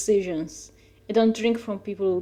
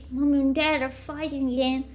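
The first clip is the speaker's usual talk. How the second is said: mock child; 5 semitones higher, slower than usual. Kid-voice joke about parents fighting.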